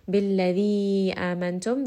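Billadhi amantum recited slowly, the vowel stretched to four counts; recited with long vowels. Shown as a demonstration. Tajweed teacher showing a four-count elongation, slow and measured. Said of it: "This is going to be a four count med".

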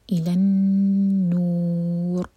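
النور with nasal noon held two seconds; ghunnah held. Shown as a demonstration. Teacher demonstrates ghunnah on النور. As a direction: hum the noon long, very slowly. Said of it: "نون کو ہم دو seconds تک hold کریں گے".

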